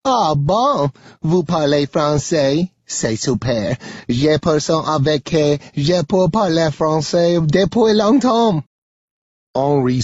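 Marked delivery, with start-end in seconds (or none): 0.0-8.6 higher-pitched, eager woman speaking French; delighted burst of French; excited French. A narrator's reading. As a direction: gush in French, rising in pitch to the end. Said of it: "Something in Henri's accent has excited her".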